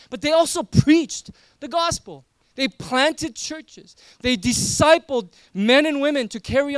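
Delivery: loud, urgent preacher's push; impassioned preaching. Acted. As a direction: drive it home to the congregation. Preaching at full force.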